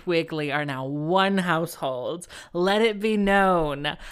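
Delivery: loud, slow, grand declaration; mock proclamation. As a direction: proclaim it grandly, drawing out each word. Mock proclamation about her household.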